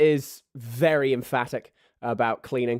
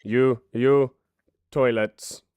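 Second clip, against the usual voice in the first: curt pirate order; 2 semitones higher, 6 dB louder, much slower than usual. Acted. Jean-Claude assigns toilets.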